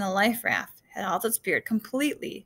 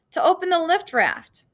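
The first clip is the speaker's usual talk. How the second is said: high-pitched raised voice; shouted; 8 semitones higher, 4 dB louder than usual. A narrator's reading. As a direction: call it back over the storm. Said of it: "Duck shouted just before he left".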